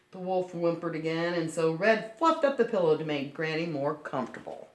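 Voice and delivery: ordinary delivery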